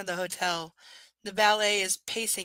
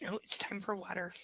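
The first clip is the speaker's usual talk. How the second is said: softer whispered aside; 8 dB quieter than usual. Real, not acted. Whispering while checking the water bowl. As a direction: murmur to yourself.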